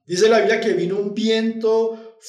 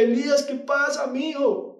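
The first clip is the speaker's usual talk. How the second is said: higher, pressing voice with a shout on mijo; urgent divine call; 5 semitones higher than usual. Acted. God rousing Elijah in the cave, urgent and raised. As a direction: shake him out of his fear. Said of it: "Dios diciéndole".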